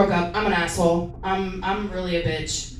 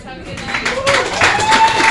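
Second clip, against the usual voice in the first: sing-song sign-off; 6 dB louder, much slower than usual. Real, not acted. Comic signs off.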